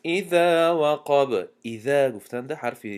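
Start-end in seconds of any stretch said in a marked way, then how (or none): 0.0-1.5 slow melodic tajweed chant, drawn out; Quran recitation. Shown as a demonstration. Teacher recites al-Falaq to students in slow tajweed chant. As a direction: recite each verse slowly, measured and melodic.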